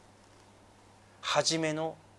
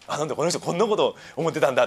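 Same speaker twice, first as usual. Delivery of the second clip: quick, loud imitation of a nosy neighbour; mock-shocked eavesdropper; 5 dB louder, faster than usual. Pastor imitating someone overhearing a prayer, mock shock. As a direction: blurt it out in playful mock surprise.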